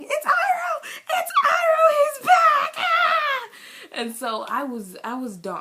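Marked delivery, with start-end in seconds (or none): 0.0-3.5 high, loud ecstatic shout; screaming with joy. Retold or imitated. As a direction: yell it out like a thrilled fan. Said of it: "I was sitting here screaming".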